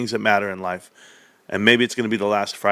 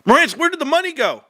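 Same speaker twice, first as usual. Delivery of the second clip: mock chat voice; 3 dB louder than usual. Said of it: "reading chat in mocking voice".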